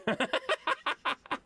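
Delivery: high, breathy burst of laughter; high giggle. Real, not acted. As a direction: giggle at the yawning dog. Laughing at the yawning dog.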